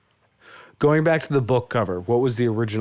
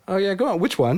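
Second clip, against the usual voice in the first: high-pitched coy imitation, amused; mock coy; 10 semitones higher than usual. Host imitating the guest's reply to Joe, coy. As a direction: play coy in a higher voice, nearly laughing. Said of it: "how coy you are about it".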